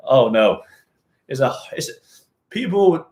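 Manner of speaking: normal talking voice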